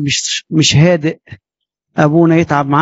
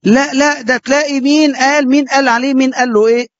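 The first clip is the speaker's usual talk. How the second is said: high, indignant protest from an offended father; hammed-up outraged defence of his son; mock angry father; 7 semitones higher than usual. Father defends his son to the priest, indignant and high. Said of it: "تلاقي أبو فلان زعق".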